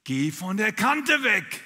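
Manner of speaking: a slow, loud, laughing call to get away from the edge; mother's warning voice. Retold or imitated. The jumper recalls her dead mother's warning, slow and loud. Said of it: "da habe ich eine Stimme gehört".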